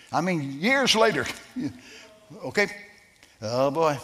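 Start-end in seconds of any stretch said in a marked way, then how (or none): none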